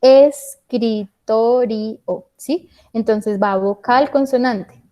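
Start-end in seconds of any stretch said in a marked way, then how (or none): 0.0-2.0 "escritorio" very slowly, split es-cri-to-ri-o; syllable by syllable. Shown as a demonstration. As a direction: break "escritorio" into five separate syllables. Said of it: "una palabra que tenga cinco sílabas".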